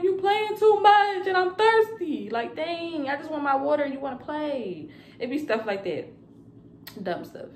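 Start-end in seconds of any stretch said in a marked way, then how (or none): 0.0-4.9 high, loud tearful whining; imitating her own weepy outburst; mock crying. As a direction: wail the complaint like a hammed-up crybaby. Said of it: "I just start crying".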